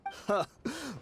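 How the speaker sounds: amused laugh. Acted. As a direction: laugh off the suspicion. Amused at the accusation.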